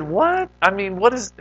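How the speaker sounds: blurting out what in amazement; stunned disbelief. Retold or imitated. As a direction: gasp out what in shock. Disbelief at Coke story.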